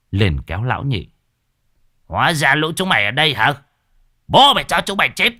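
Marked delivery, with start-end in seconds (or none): 2.0-5.3 high, fast, roaring threat at the ghosts; a taunting battle cry, fast and loud; furious shout. Acted. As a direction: bellow it out like a raging exorcist. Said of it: "Lão Nhị rút một đạo bùa".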